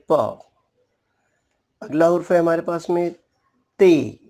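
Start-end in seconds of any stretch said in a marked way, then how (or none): 0.1-0.4 प said as a short p with a light vowel; letter sound p. Shown as a demonstration. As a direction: pop प short. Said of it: "इसकी आवाज़ है".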